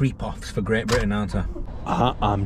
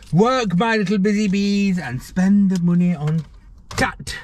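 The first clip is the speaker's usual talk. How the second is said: mock overlord; 7 semitones higher than usual. Mock overlord addressing shoppers.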